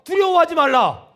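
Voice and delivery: booming call for repetition; loud proclamation. Real, not acted. Preacher leading congregation to repeat, loud proclamation. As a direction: proclaim it loudly for all to repeat.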